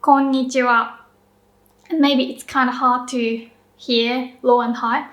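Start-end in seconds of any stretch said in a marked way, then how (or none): 0.0-0.9 Konnichiwa in one quick natural run; quick everyday Konnichiwa; said quickly. Shown as a demonstration. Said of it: "If I say it quickly".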